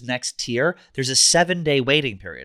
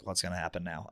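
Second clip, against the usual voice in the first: much softer, lower muttered worry; wary aside; 6 semitones lower, 14 dB quieter than usual. Retold or imitated. Biker wonders about the stranger, softly to himself. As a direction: say it under your breath, uneasy.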